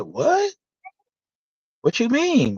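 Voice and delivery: shocked high question, pitch jumping way up; asking back in disbelief, voice leaping high; surprised. Retold or imitated. Retold shock at the news.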